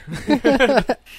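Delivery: high, loud burst of laughter. Real, not acted. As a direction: laugh out loud at your own story. Laughing at his own story.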